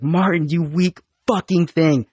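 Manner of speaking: high barked drill instructor taunt. Retold or imitated. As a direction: yell down at a weak recruit. Drill instructor yelling at recruit.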